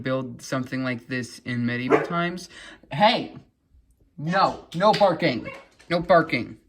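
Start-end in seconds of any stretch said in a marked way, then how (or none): 2.9-6.6 sharp, loud no to the dog; hushing a pet. Real, not acted. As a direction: scold the barking dog firmly. Dog barking, speaker scolds it.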